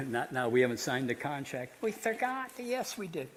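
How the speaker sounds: cheeky puppet haggling over pay; ventriloquist's puppet bantering; laughing puppet. Acted. Puppet banters about payment.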